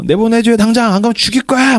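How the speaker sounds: high-pitched, hammed-up threat; angry threat. Tao trapped behind the iron door, angrily threatening Walt. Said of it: "막 그러면서 이제 욕을 해요".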